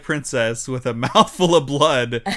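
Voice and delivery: laughing through the words. Real, not acted. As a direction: giggle while saying it. Laughing while describing fantasy.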